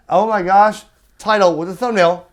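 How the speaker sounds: ordinary talk, nothing marked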